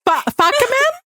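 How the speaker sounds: "fuck a man" with a rising question tune; misreading the name. Retold or imitated. Cohost testing how the name was misread. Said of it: "Freddie Fakeman".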